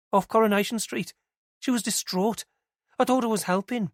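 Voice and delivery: worried she did wrong. Acted.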